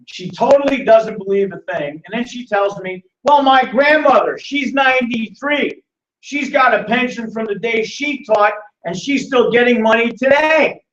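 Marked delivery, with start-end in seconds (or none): 3.2-10.8 high, slow mock-insistent voice; slow, high-pitched mimicry, spelling it out; mimicked proud teacher. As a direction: imitate a teacher making her case, drawn out. Mimics the teacher arguing about pensions.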